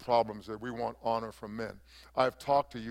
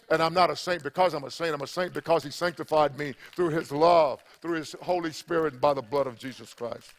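loud, fervent preaching; 3 semitones higher, 5 dB louder than usual. Acted. Pastor preaching to his church in full fervour.

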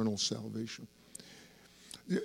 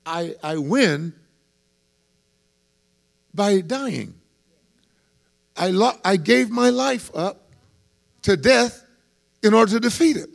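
fiery preaching; 9 semitones higher, 9 dB louder than usual. Acted. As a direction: preach it to the rafters. Preacher at full force.

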